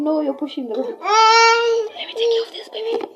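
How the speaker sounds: retold tearful friend; friend's pleading voice. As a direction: beg her like a worried friend. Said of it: "Then she was like".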